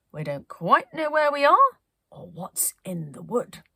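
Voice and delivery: reassuring but wary; Penelope's voice. Acted. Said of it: "she said".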